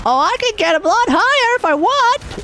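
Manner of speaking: playful high-pitched demo. Shown as a demonstration. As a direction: voice jumps up high. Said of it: "You mean my voice?".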